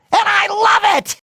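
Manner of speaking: high, gleeful cartoon cry; thrilled shout. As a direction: shout it with delight. Thrilled shout after feeling sick.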